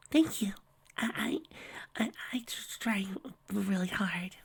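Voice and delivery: high, fading to a whisper; bashful thanks, trailing off; timid high whisper; shy Fluttershy. Acted.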